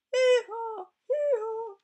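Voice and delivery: very high loud braying; donkey bray. Acted.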